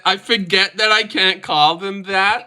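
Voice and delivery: high, slow laughing mockery; slow laughing mimicry pitched high; mocking her. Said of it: "She forget".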